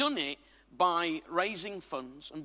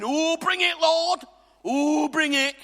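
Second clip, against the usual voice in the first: loud, high Yorkshire mock plea; hammed-up Yorkshire cry to the Lord; Yorkshire accent; 7 semitones higher, 7 dB louder, slower than usual. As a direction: call out in broad Yorkshire. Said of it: "I went all Yorkshire".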